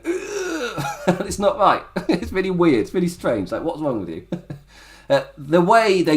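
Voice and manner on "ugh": high strained mock groan; tense-neck groan. Shown as a demonstration. Host showing the wrestler impression minus its accent, strained groan. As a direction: force out a high noise from a tight throat. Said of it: "you're just going".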